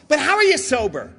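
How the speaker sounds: ordinary talk, nothing marked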